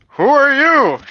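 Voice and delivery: high, loud, raspy creature asking; a rasping beast demanding who they are; raspy griffin voice. Acted. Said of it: "the griffin asked in a raspy voice".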